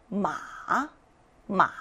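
마 dipping low, then rising; third tone. Shown as a demonstration. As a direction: sink each 마 to the bottom and bring it back up. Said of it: "삼성이에요".

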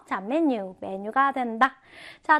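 ordinary delivery

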